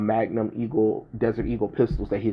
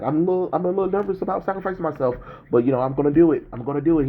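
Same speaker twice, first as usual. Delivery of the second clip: high, fast nervous bravado; nervous Deadpool; 7 semitones higher, much faster than usual. Retold or imitated. Deadpool about to sacrifice himself, high nervous bravado. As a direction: rush it like you're talking yourself into it. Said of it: "he was like".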